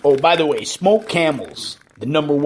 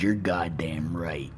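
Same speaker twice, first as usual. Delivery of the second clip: whispered retort; 8 semitones lower, 8 dB quieter than usual. Retold or imitated. Father's reply to teacher.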